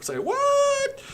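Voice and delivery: high, startled squeak of disbelief; wife's surprised reply. Retold or imitated. Wife hearing he'll livestream tonight, high startled squeak. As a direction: pitch it way up, caught off guard. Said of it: "And she was like".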